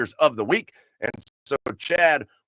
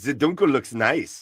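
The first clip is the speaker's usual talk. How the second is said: put-on slow, playful German accent; put-on German accent, savoring it. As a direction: draw it out in mock German. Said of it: "But in a German accent".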